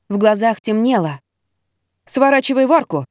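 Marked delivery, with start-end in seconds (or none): 2.1-3.0 ordering. Acted. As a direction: command her to turn. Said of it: "велела Ирина". (приказным тоном)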